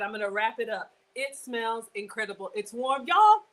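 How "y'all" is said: high, loud "y'all"; excited. Real, not acted. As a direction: shout it out to the crowd. Excited call to viewers.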